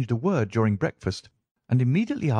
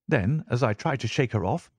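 usual speaking voice

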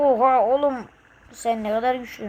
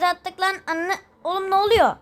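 rising higher in alarm; startled cry; 6 semitones higher than usual. Real, not acted. Streamer reacting to game crash, startled cry. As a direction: yelp at the crash.

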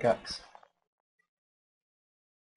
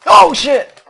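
startled yelp; 18 dB louder than usual. Real, not acted. Sudden scare in game.

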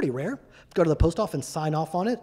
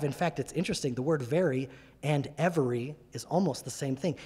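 usual speaking voice

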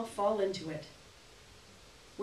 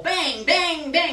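radiator bangs; 7 semitones higher, 8 dB louder, much slower than usual. Retold or imitated. Imitating the clanging radiator.

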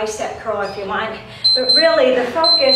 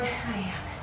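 breathless, winded answer; out of breath; 3 semitones lower, 12 dB quieter than usual. Real, not acted. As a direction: gasp it out after a hard set. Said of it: "You breathing hard?".

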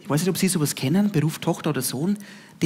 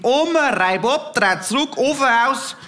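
higher, slow call into the kitchen; calling to grandma; 7 semitones higher than usual. Retold or imitated. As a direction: call out to Oma, a bit urgent. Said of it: "sag ich".

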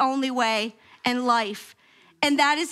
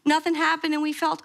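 normal talking voice

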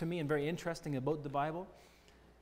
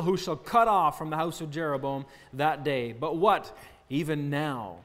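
forceful scripture reading; 4 dB louder than usual. Acted. Preacher reading Ahijah's prophecy to congregation, proclaiming. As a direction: declaim the prophecy to the room.

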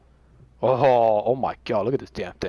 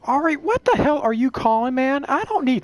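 exasperated high outburst; annoyed; 10 semitones higher than usual. Real, not acted. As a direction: snap at the caller. Annoyed at phone call.

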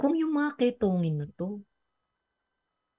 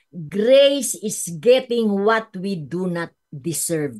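ordinary talk, nothing marked

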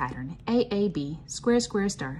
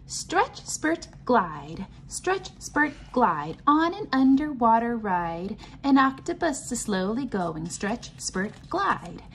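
lively sing-song read-aloud, chanted refrains higher; reading to children; 7 semitones higher, slower than usual. Acted. Teacher reading a pattern book to the class, bouncy sing-song chant. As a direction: chant the refrains like a nursery rhyme for little ones.

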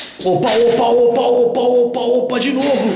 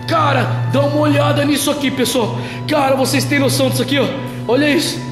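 excited shouting; 8 semitones higher than usual. Real, not acted. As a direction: freak out at what you see. Sudden paranormal event, excited. (empolgado e assustado, gritando)

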